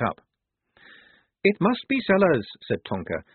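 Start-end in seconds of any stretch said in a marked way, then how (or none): 1.4-2.5 high, forthright character voice; raised, confident pronouncement; Tonka's voice. A narrator's reading. Tonka reports on the tunnel.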